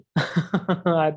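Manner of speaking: short amused chuckle; light chuckle. Real, not acted. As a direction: chuckle lightly, brushing off the question. Chuckles while deflecting discount question.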